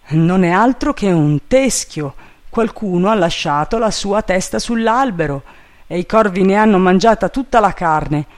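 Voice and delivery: lightly voiced servant, quickening at the end; reporting servant Jupiter. A narrator's reading. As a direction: call the finding down.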